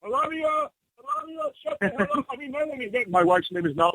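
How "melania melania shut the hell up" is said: high yell away from the phone; yelling for Melania. Acted. Caller yelling for his wife away from the phone. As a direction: call out sharply across the room.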